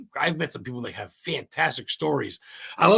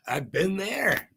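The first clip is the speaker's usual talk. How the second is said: "I've been there" stretched out slowly and a bit higher; slow, drawn out; 5 semitones higher, much slower than usual. Shown as a demonstration. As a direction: linger on every word of "I've been there". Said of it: "I've been there because why not".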